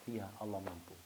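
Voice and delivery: very soft, subdued answer; hushed fearful reply. Retold or imitated. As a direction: barely voice it in awe. The Shaykh answers in fear. (jawaban sangat lirih karena takut)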